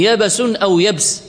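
يبس said yabas then yabs, slowly; first يبس with a moving middle, then still; two forms of yabis. Shown as a demonstration. Teacher contrasting يبس forms slowly for class. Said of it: "إما بتحريك أو بفتح".

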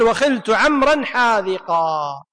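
slow, drawn-out reading of the verse line; teacher reading the grammar verse slowly; slow recitation. Shown as a demonstration. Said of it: "المصنف قال".

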